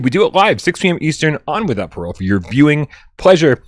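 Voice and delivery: fast, loud show opener; announcer intro. Acted. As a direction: hype up the show open.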